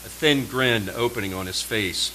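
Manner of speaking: plain reading voice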